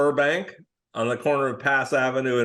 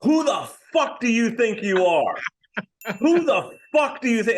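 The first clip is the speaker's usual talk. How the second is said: retold yelling; 9 semitones higher than usual. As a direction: bark it like a furious producer on the phone. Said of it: "a guy just starts yelling".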